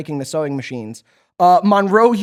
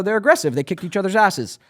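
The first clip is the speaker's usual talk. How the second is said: usual speaking voice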